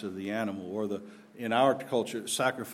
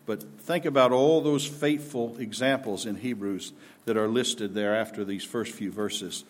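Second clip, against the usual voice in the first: ordinary talk, nothing marked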